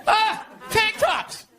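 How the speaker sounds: slow, high, drawn-out ah of understanding; mock realization. Catching on about Pride Month, slow high drawl. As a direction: stretch it out like it finally clicks. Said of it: "It's like".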